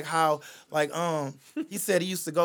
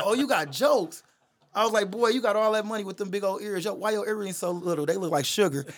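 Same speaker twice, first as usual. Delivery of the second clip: mock roast; 5 semitones higher, 5 dB louder than usual.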